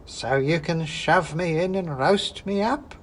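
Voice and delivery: a witch teasing with sly suspicion; suspicious old witch. Acted. Witch mocks the wizard's offer, slow and sly. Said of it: "said the woman".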